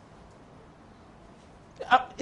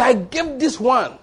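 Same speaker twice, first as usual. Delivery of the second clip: drawn-out, slow proud claim; slow boastful believer; 6 semitones higher, slower than usual. Acted. A believer boasting of his giving to God, slow and smug. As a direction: stretch it out like a smug giver.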